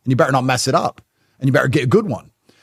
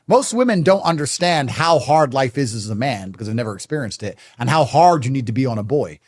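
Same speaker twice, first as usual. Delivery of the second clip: ordinary delivery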